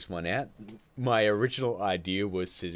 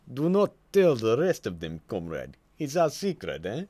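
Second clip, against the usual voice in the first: hammed-up Russian accent, louder and higher, conspiratorial; mock Russian accent; 4 semitones higher, 5 dB louder than usual. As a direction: play a jokey Russian spy sharing a secret in a Russian accent. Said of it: "I am a Russian posing an Australian".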